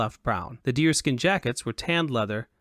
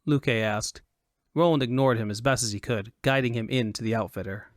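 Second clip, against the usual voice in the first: plain reading voice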